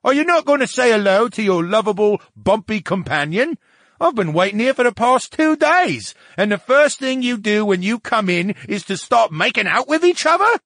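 loud, high, affronted character voice; high-pitched huffy outburst; indignant talking brain. Acted. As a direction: complain noisily at being ignored.

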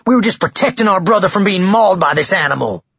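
high, heated accusation; worked-up, high-pitched self-justification; indignant protest. Acted. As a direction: argue your innocence, outraged. Frat boy protests to officer.

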